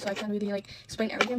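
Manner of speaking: ordinary talk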